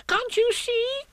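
extremely high shrieky little voice; shouted squeal. A narrator's reading. Piglet shouting at Kanga, squeaky shout. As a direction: squeal it out in protest. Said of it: "shouted Piglet".

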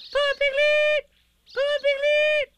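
tiny, very high drawn-out squeal; excited squeak. A narrator's reading. Roo calling down from the tree, excited squeak. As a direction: squeak it like an excited little animal. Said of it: "Something very excited ... squeaked".

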